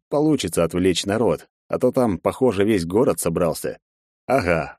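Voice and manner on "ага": ordinary delivery, nothing marked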